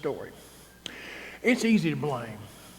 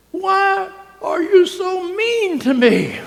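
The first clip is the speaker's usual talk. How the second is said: very high, loud, slow wounded whine; hurt Martha; 11 semitones higher, 8 dB louder, much slower than usual. Retold or imitated. Martha answering Jesus, high and wounded, hammed-up. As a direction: wail it like a hurt child. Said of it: "Martha goes".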